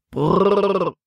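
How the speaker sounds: long rolled whoa-sound; trilled "brrr" to horses. A narrator's reading. Paul halting his wagon horses, trilled call. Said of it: "rief Paul den Pferden zu".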